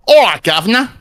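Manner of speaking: put-on a cheeky Cockney soldier's greeting in a British accent; chirpy British squaddie accent; British soldier accent, jaunty. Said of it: "like a British shoulder, shou-, shoulder soldier".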